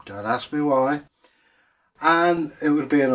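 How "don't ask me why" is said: plain reading voice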